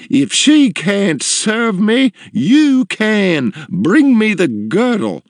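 high, slow demanding matron; bossy woman, drawn-out commands; imperious customer. A narrator's reading. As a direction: order the staff about, high and emphatic. Customer orders another girl.

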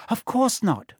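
brisk, high-pitched dismissal; no-nonsense reply. A narrator's reading. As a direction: brush the doubt aside briskly. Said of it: "said Hermione briskly".

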